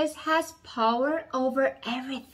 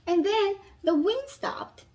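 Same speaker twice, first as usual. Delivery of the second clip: dramatic storytelling. A narrator's reading. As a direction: lively voice for a children's Bible story.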